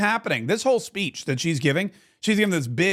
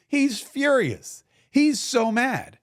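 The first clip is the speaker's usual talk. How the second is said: indignant emphasis; 6 semitones higher, much slower than usual. Real, not acted. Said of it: "building indignation".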